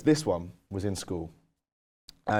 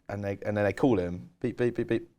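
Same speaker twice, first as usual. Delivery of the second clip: ordinary talk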